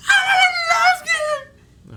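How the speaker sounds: high, slow, delighted squeal; squealing kid voice. Retold or imitated. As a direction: squeal it like an excited little girl. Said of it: "she just went".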